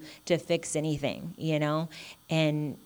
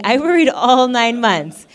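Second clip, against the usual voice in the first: higher, louder and slow; emphatic repeat; 6 semitones higher, 12 dB louder than usual. Real, not acted. Mother insisting to the congregation, emphatic and loud. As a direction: lean hard on every word.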